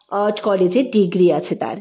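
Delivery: ordinary delivery, nothing marked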